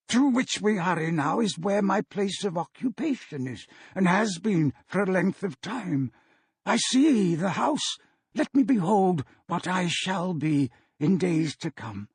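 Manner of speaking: put-on an anxious old man hurrying the spirit; Scrooge voice.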